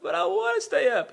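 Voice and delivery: high, loud whiny protest; hammed-up pleading wail; mock crying child. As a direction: imitate a kid about to cry. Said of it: "He starts crying".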